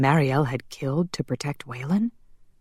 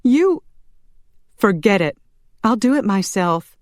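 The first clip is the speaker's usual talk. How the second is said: high, louder woman's voice; exasperated outburst; 6 semitones higher, 5 dB louder than usual. Acted. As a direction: snap at them, fed up. Fed-up daughter breaks up argument.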